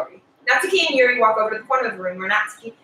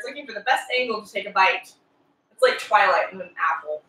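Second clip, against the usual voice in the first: ordinary delivery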